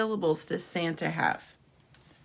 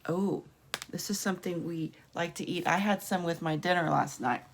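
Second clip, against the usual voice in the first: ordinary talk, nothing marked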